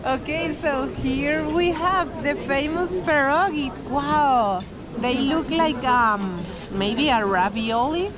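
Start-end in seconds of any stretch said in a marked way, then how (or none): none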